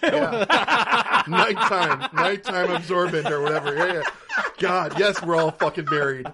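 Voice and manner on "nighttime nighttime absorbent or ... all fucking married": laughing through the words, higher and louder; cracking up while listing pad types. Real, not acted. Teasing friends about pads, laughing hard.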